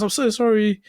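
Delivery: high-pitched imitated apology; mock apology. Injured man apologising to the driver, mock apology. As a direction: apologise high, as a retold quote.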